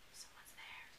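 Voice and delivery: breathy creepy whisper; hushed scary whisper; spooky whisper. Acted. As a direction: whisper it like a ghost story.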